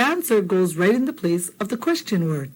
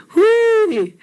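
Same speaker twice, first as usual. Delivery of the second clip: high, loud hooey like the auntie calling in; auntie's hooey call; 13 semitones higher, 6 dB louder than usual. Retold or imitated. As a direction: announce yourself from outside. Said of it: "we always knew it was her when she would say".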